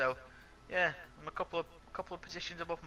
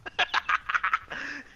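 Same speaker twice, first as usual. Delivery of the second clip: high laugh; 13 semitones higher, 4 dB louder than usual. Real, not acted. Teammate laughing at disqualification.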